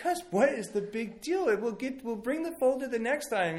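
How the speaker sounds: put-on high-pitched pleading with a toddler; acted-out exasperated dad; exasperated parent.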